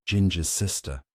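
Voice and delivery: ordinary delivery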